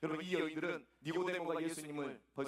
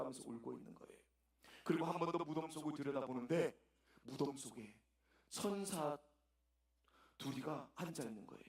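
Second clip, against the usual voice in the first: ordinary delivery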